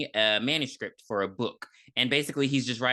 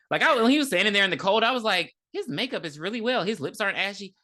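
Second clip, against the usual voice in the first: excited; 6 semitones higher, faster than usual. Real, not acted. Watching the episode and gushing about the actor's makeup, excited. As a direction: gush over the actor's makeup.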